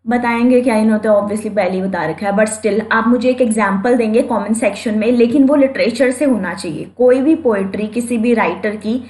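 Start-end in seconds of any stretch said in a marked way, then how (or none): none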